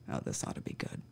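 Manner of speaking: breathy whispered sarcastic aside. Real, not acted. Officer whispering a sarcastic aside about the story, whispered. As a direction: mutter it under your breath, unimpressed.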